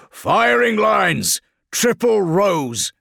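high, loud, slow command; drawn-out battlefield command; shouted orders. Acted. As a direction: bellow formations to the troops. Commander shouting orders to his troops.

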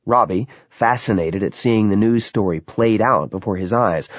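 plain reading voice

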